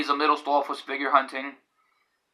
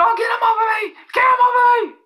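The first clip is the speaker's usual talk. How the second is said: very high, panicked mock shout; shrieked plea, acted out; imitated screaming; 5 dB louder, faster than usual. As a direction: yell for help as if a dog is attacking. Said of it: "And then he was screaming".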